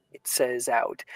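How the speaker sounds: ordinary talk, nothing marked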